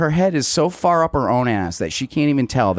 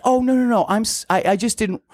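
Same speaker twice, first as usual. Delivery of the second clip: mock flustered Liz in a higher voice; imitating Liz, higher; 10 semitones higher than usual. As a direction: play her rattled and pitched up. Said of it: "she's like".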